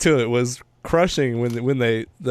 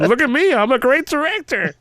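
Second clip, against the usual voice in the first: much higher, showy bragging voice; mock bragging director; 12 semitones higher than usual. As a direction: crow like a smug director showing off.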